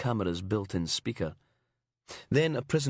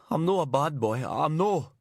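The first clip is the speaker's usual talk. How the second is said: broad Aberdonian, whining and higher; Aberdonian accent slipping out; 5 semitones higher, 3 dB louder than usual. Acted. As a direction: let the fake English drop into Aberdonian. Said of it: "exposing the Aberdonian underneath".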